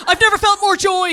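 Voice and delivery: high, shouted false elation; manic, over-insistent happy yell; frantic joy. Acted. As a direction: scream it like a desperate parent. Acting exhausted parents selling joy.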